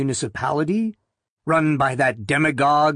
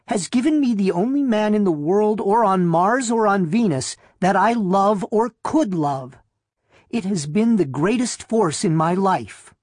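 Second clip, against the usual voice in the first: higher, impassioned woman's declaration; unyielding; 6 semitones higher than usual. A narrator's reading. Wife declaring her love for her husband, unyielding. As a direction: pitch it up and refuse to back down. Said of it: "was unyielding".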